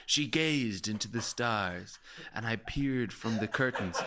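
slow, mock-poetic storytelling; flowery narration. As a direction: read it slowly like a romantic novel. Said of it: "all this flowery language".

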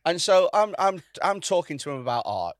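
ordinary talk, nothing marked

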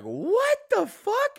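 slow, very high mock shriek; loud, squeaky disbelief, drawn out; incredulous outburst. Student reacting to being called a stalker, incredulous outburst.

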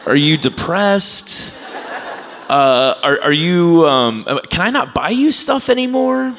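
hammed-up impression of his fretting mother; worried mom. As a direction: squeak out mom's panic, half laughing. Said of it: "she's like".

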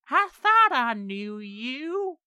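slow, drawn-out high menacing voice; slow creepy reveal of the demon; sinister demon. Acted. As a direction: savour each word like a revealed devil. GM voices demon Corey revealing himself.

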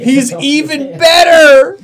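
loud, slow, cry-like shout of glee; delighted shout. Real, not acted. Gushing over zombie Drew with a loud delighted shout. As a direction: squeal it out with overjoyed, tearful delight. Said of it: "listener laughs".

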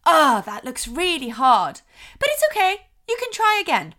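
high, loud cheery reassurance; parent jumping to solutions. Shown as a demonstration. Parent hurrying to fix a child's frustration, over-eager reassurance. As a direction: rush in to encourage the child. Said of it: "We're not coming in to say".

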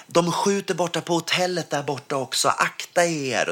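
retold warning shout. A guy in the street warns everyone, in an imitated high urgent call. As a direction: call out the warning, slowing on the last words. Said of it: "kommer någon kille och skriker så här".

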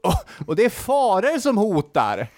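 high-pitched warning cry, no real volume; mock shouting. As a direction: imitate an orator crying out a dire warning. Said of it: "börjar ropa att".

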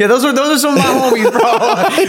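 laughing through the list of names, high and bright. Real, not acted. Recalling his old street homies to the host, laughing.

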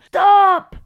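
high, loud, terrified cry; panicked scream. Retold or imitated. Terrified patient screams at healer to stop. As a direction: scream to make him stop. She panics, needle near spine.

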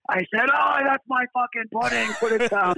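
pitched well up in hammed-up indignation; mock outraged producer. Producer retelling his pudding outrage to the band, hammed-up high indignation. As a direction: play the producer's fake fury over his pudding. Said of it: "he told us the version, like".

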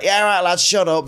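a lad mouthing back to his mates at the station; cocky northern lad. Retold or imitated. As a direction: play it hard for the bullies. Brushing off the local bullies.

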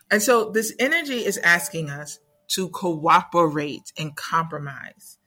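ordinary talk

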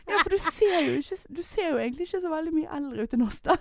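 high, lighter mock fan voice; gushing fan. Imitating the fan reassuring her she looks young. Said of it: "Og så bare".

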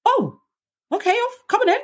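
very high, exaggerated mock officer; hammed-up high officer voice; imitating the officer. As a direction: squeak it like a flustered guard waving her in. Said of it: "He was like".

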